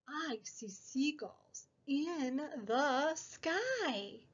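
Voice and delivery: slow, gentle storybook reading; reading to children. Acted. Teacher reads a picture book to preschoolers, slow and gentle. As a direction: read each page slowly for little ones.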